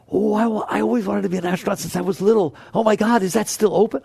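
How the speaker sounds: put-on high, fast, giddy childhood dream; mock-excited mind chattering; excited inner voice. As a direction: gush like a kid who just remembered a dream.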